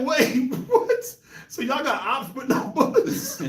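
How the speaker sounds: mock shock through giggles; laughing disbelief. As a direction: ask in amused disbelief. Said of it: "I was like".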